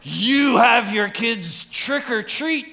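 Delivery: high, slow, scandalized question; mock shock. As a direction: gasp it out like an appalled church member. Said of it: "that's terrible".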